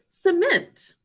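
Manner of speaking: slow, clear teacher model of cement; careful spelling-lesson read; teacher saying the word. Shown as a demonstration. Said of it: "would you all say cement".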